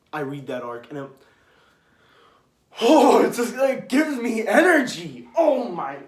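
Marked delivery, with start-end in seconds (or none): 2.8-6.0 much higher and louder, slowing on the last words; real fan outburst; excited shout. Real, not acted. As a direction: shout it out, energised by the memory. Gushing about favourite arc.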